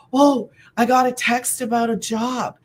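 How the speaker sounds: mock thrilled announcement; excited. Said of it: "And I was so excited".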